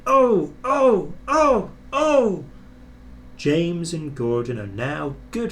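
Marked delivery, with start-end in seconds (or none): 0.1-2.4 high, loud bumped trucks' cries; trucks crying out. A narrator's reading. Trucks bumped by Gordon, crying out loud. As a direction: yelp as jolted trucks. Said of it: "making them cry".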